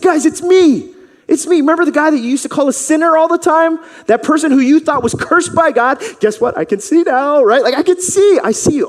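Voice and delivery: high, loud and fast pleading; eager excitement. Acted. Healed man convincing doubtful neighbors, high and eager. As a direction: insist happily it's really him. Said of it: "He's like".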